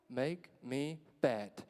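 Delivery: slow, low deadpan punchline. Real, not acted. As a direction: drop the line slowly. Punchline about medication.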